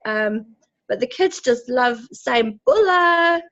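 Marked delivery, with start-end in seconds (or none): 2.7-3.4 put-on kids' greeting call. Kids greeting people from bus.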